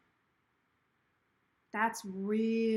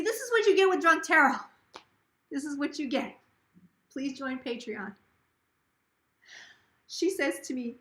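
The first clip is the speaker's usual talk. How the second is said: usual speaking voice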